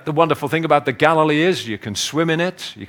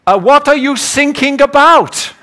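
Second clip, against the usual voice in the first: German coast guard; 13 semitones higher, 10 dB louder than usual. Retold or imitated. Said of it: "he says".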